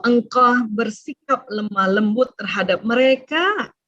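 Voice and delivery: normal talking voice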